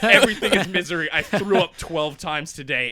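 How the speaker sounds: loud, fast, laughing misery rant; mock despair. Trainee's diary falling apart, mock despair.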